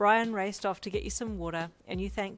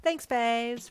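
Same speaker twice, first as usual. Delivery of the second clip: flamboyant voice; 6 semitones higher, 7 dB louder than usual. A narrator's reading. Flamboyant Brian voice.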